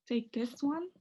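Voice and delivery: ordinary talk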